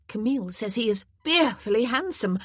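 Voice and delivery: high, loud gushing over fearfully; eager gossip. Acted. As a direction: gush the word fearfully with girlish delight.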